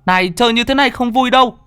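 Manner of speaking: high, loud frantic calling across the river. A narrator's reading. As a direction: shout for the missing friend, panicked. Children calling missing friend. (gọi to, hoảng hốt, giọng cao)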